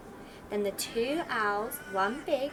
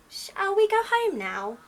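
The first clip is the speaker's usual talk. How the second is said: high, louder little chick asking; little owl voice; 10 semitones higher, 6 dB louder than usual. Acted. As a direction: pitch it up like a small child.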